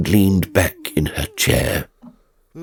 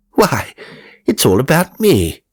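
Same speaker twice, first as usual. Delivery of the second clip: raised, delighted voice; exultant; 6 semitones higher, 4 dB louder than usual. A narrator's reading. Ghost thrilled to read of his own death. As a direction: crow with glee at seeing himself in the news.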